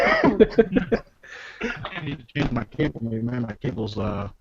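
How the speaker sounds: muffled, like talking underwater; garbled bad mic sound. Real, not acted. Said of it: "It sounds like you're underwater".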